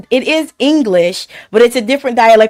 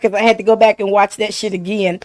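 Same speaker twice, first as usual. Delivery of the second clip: ordinary talk, nothing marked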